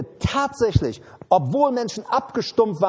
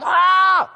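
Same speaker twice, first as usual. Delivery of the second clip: short high loud cry of a burnt mouth; pained scream; 10 semitones higher, 6 dB louder than usual. Retold or imitated. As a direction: yelp as if scalded by coffee. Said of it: "Du würdest schreien". (lauter, hoher Schmerzensschrei)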